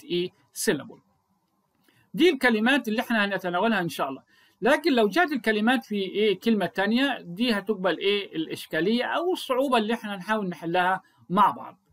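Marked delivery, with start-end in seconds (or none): none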